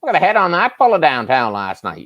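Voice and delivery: high, hammed-up Maritime accent; joking like a Maritimer out with the boys. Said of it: "you just start talking like that".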